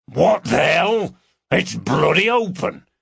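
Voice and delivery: a big burly man caught off guard; gruff intruder surprised. Acted. Cartwright finds door open.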